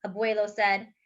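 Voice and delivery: plain reading voice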